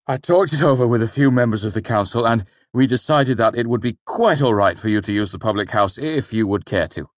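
a sweaty mayor rushing an offer; flustered mayor. A narrator's reading. Mayor voice held.

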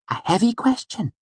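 put-on very high, light girlish voice; Auri. A narrator's reading. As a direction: pronounce it daintily in a high voice. Auri answers him.

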